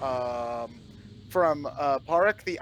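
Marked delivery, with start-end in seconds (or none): none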